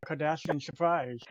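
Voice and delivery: usual speaking voice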